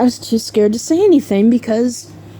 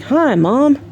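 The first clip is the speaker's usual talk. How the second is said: higher, falsely casual hello; mock innocent greeting; 6 semitones higher than usual. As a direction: act like nothing happened. Fake innocent greeting to mom.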